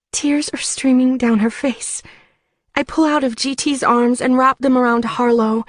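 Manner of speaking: normal talking voice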